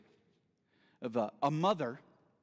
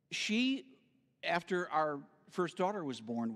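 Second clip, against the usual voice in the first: usual speaking voice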